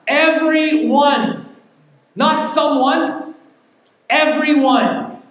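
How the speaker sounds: Everyone and Not someone slow, loud and drawn out; stress on Everyone. Acted. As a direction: pound each word as if in all caps. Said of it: "in all caps".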